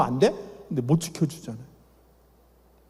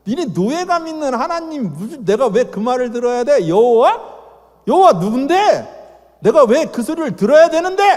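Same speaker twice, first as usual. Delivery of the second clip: high sneering Pharaoh voice; mocking Pharaoh; 5 semitones higher, 4 dB louder than usual. Pharaoh mocking the God of Moses, high and sneering. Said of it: "까는 소리예요".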